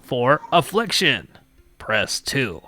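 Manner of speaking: loud, slow, drawn-out recorded-menu voice; automated phone menu. Pretend. Joking Schrader Phone hotline reading menu options, hammed-up automated voice. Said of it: "welcome to Schrader Phone".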